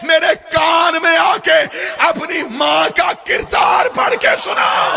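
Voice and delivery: high, slow shouted taunt; mock shout. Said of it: "اونچی آواز میں". (اونچی آواز میں للکار)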